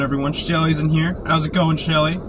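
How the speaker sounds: ordinary delivery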